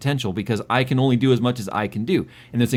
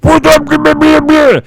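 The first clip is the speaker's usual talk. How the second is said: much higher and louder, slow bellow; Jerry imitated bellowing for a beer; mock loud demand; 13 semitones higher, 13 dB louder, slower than usual. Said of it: "having Jerry be like".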